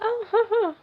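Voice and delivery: high, nervous giggle; nervous laugh. Retold or imitated. Flustered by him at the door, she giggles high and nervously. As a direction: giggle awkwardly.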